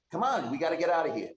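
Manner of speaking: quick, high, pressing whisper-urge to flee; urgent Tarina. Acted. GM voices Tarina urging escape.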